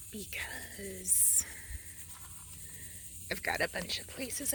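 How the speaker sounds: soft hushed campsite talk; whispering. Real, not acted.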